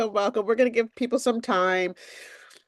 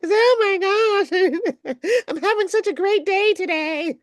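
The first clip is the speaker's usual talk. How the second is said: much higher, giggly over-cheerful voice; bubbly impression; 11 semitones higher than usual. Host showing her usual impression of anyone, high and giggly. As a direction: gush like a ditzy happy person. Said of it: "I always have to make it sound like that".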